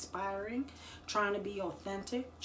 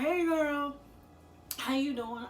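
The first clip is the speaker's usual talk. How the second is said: put-on "Hey, girl" lifted high and bright, then easing down; lightly voiced friend reaching out warmly; friendly greeting; 7 semitones higher, 3 dB louder than usual. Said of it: "she didn't say it like that, but she said".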